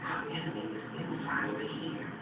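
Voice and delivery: very soft, low and confiding; hushed reveal. Real, not acted. Preacher revealing the enemy within to the audience, hushed. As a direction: drop to a near-whisper. Hushed punchline after raised contrast.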